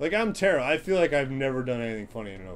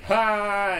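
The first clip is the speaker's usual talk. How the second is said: sharp ha laugh; 8 semitones higher, 7 dB louder than usual. Shown as a demonstration. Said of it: "start off like".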